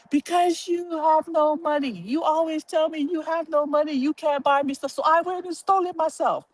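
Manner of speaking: high, whiny victim plea; fake crying plea. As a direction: play the wronged child to guilt dad. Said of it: "I played the victim".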